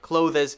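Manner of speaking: "Clothes" mispronounced "clo-thez"; "Clothes" with extra vowel before the Z; wrong pronunciation. Shown as a demonstration. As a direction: say "Clothes" slowly in two beats. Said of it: "Clothes is wrong".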